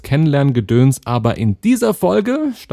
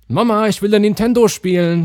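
put-on whiny little kid, higher; child voice; 9 semitones higher than usual. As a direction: beg like a small kid.

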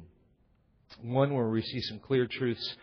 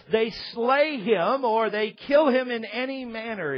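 louder, higher preaching build; full-force preaching; 8 semitones higher, 4 dB louder than usual. Acted. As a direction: drive the story home to the congregation.

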